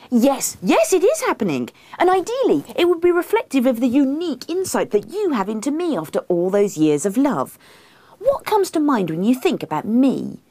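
high, eager, pressing voice; insistent wife. A narrator's reading. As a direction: push him earnestly for an answer. Acts herself insisting.

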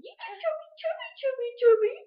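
put-on very high squeaky cooing at the baby; baby talk. Shown as a demonstration. Mom trying again to make her baby laugh, very high baby talk. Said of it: "you go".

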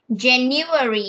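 slow, clear model word January. Shown as a demonstration. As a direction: say January slowly for the child to copy. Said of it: "repeat after me".

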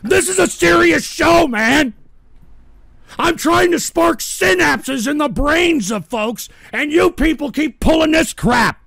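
exasperated yelling at the audience; indignant shouting. Real, not acted. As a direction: insist angrily on being taken seriously. Host protests trolls ruining show.

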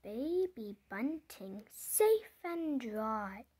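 usual speaking voice

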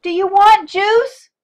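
high, slow, screamed question. A narrator's reading. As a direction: scream it across a deafening room. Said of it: "Mom screamed".

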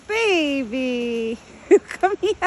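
high, loud coaxing call; calling an animal. Real, not acted. Woman calls her buck to come here, calling out. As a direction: call out to coax a big pet over.

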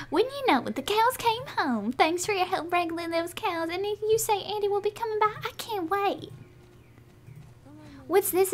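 high-pitched, lively game character; cheerful farm character. Acted. Farmer thanking helper for wrangling cows, cheerful high voice. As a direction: pitch it up and play an eager farm gal.

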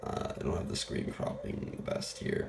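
ordinary talk

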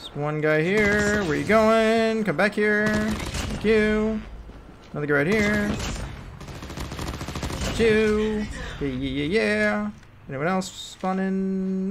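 drawn-out, high held notes; playful singsong. Real, not acted. Player picking off one guy after another, playful singsong. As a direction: stretch the words out in a singsong taunt.

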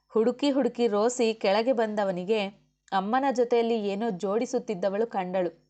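usual speaking voice

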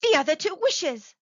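high, loud eager answer; rapid, eager reply. A narrator's reading. Wife explaining her idea to her husband, eagerly. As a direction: answer at once, urgently. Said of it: "she replied rapidly".

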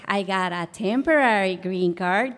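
ordinary talk

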